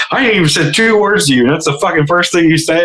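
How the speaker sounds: high-pitched, incredulous griping; indignant complaint. Retold or imitated. Security guy confronts him over the one-liner, high indignation. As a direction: imitate an offended guy protesting. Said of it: "he's like".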